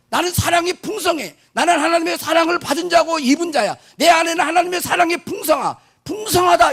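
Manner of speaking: high, shouted confession of faith; bold declaration. Shown as a demonstration. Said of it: "이렇게 말해야지".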